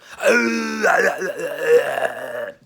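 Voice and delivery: loud, high strained roar imitating a beast; hammed-up creature roar; monster growl. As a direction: let out a big monster growl. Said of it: "like some fucking monster growling".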